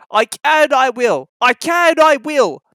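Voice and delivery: puffing determination. Acted. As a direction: chant it like a straining engine. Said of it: "puffed James".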